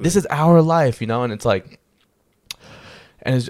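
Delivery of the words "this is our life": raised, higher retold insistence; mad at himself. Reflection lecturing him on their shared life, retold insistence. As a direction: drive it home as the reflection, pitched up and louder. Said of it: "getting mad at myself".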